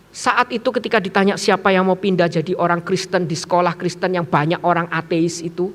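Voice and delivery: ordinary delivery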